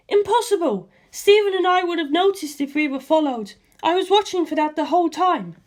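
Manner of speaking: a high, insistent denial; indignant Lisa Lisa. A narrator's reading. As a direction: reject the idea flatly. Lisa Lisa protests.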